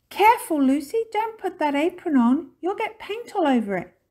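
high, loud cautioning; urgent warning. A narrator's reading. Mister Topsy-Turvy warning Lucy about paint, high and urgent. Father warns child in story.

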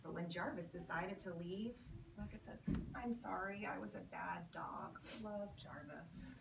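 ordinary talk

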